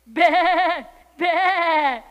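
loud, high, wavering baa; sheep bleat. A narrator's reading. Sheep answers the kitten with loud, high bleats.

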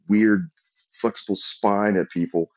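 plain reading voice

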